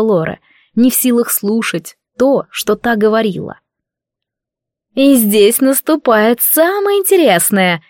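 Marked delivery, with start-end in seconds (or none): 4.9-7.8 gleeful. A narrator's reading. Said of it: "голос Лоры прозвучал радостно".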